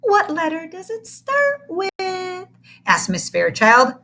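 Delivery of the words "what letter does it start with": slow, high, sing-song chirp; a syrupy kindergarten teacher cooing; chirpy teacher. Acted. Teacher greets the big new boy, chirpy and high.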